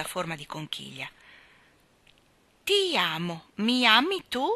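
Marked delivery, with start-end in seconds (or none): 2.6-4.5 slightly raised read-aloud voice; reading out loud. A narrator's reading. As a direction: read it out, rising on the question. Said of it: "lesse forte". (letto forte)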